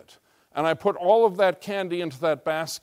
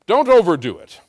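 louder mock repeat of the stingy missionary; mocking echo; 8 dB louder than usual. Pastor mocking the cheap missionary, scornful echo. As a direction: throw his words back with scorn. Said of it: "I don't know why missionaries are so cheap".

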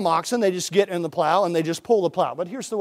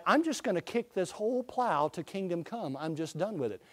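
muttering ox; 12 dB quieter than usual. Retold or imitated. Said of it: "that ox would just decide".

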